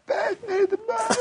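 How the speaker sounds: imitated thin, very high crying whine; mock-tearful child's plea at the table; whining little girl. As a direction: squeal it like a whiny five-year-old. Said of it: "kind of whining".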